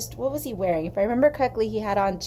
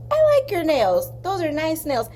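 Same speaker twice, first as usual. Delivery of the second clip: higher, louder voice for the waitress; gruff Midwestern waitress; 7 semitones higher, 3 dB louder than usual. Retold or imitated. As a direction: play the tough diner waitress sizing her up. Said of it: "big, gruff Midwestern women".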